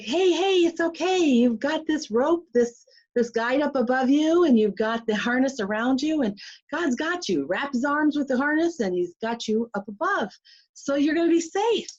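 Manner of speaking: high, eager reassurance; reassuring. Retold or imitated. Calming her frightened friend at the zip line edge, high reassuring voice. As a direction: soothe a scared friend with brightness.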